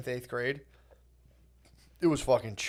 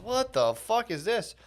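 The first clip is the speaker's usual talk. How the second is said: slow, higher-pitched incredulous drawl; acted-out stunned crowd reaction; baffled disbelief; 7 semitones higher, 3 dB quieter, slower than usual. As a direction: draw it out like a stunned onlooker. Everyone gawking at the nerd walk.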